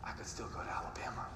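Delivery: near-whispered aside to dad; re-enacted furtive whisper; hushed confession. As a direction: confide it very softly, as if secretly. Confiding to dad while miked up.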